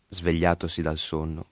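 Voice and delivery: ordinary delivery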